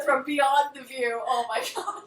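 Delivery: usual speaking voice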